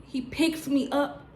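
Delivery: normal talking voice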